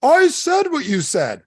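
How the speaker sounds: high, loud, stretched-out protest; mock protest with long high vowels; mock whining objector. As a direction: imitate an indignant audience member, high-pitched and drawn out. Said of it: "some of you are gonna be like".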